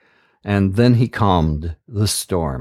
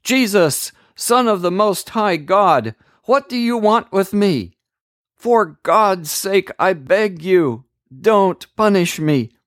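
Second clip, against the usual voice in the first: high-pitched pleading demon voice, no louder; possessed man screaming; 9 semitones higher than usual. A narrator's reading. Possessed man begs Jesus not to punish him, high pleading cry. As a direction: cry out high and desperate, begging for mercy. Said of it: "and screamed in a loud voice".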